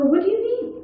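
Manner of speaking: shrill defensive rise. Retold or imitated. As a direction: push back, voice jumping up. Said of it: "obviously your voice goes up".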